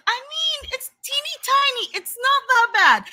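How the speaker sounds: very high, placating squeak; compliant inner voice. Acted. Her compliant side excusing the blood, high and placating. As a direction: minimize it in a high little voice. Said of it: "the compliant part of me was like".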